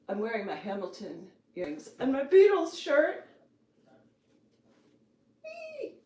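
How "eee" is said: excited squeal. Real, not acted. Squeal at cat.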